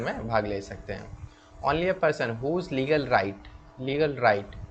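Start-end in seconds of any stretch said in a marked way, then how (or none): 1.6-4.6 dictating slowly. Shown as a demonstration. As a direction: read out the legal rule for students to copy. Reading legal definition slowly.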